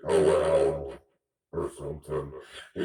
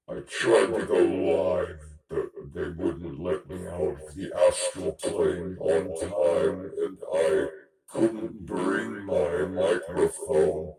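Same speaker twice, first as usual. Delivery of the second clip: normal talking voice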